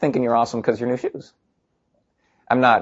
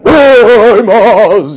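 booming wizard; 8 semitones higher, 9 dB louder than usual. Retold or imitated.

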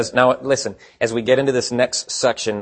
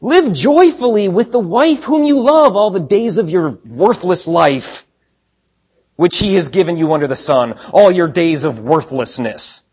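higher and louder, mocking scripture read; sarcastic reading; 5 semitones higher, 5 dB louder than usual. Shown as a demonstration. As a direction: let the sarcasm drip, lean on worthless. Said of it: "Now, hear the sarcasm in this".

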